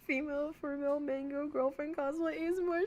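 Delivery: high-pitched sing-song title announcement; hammed-up ASMR intro; mock roleplay title.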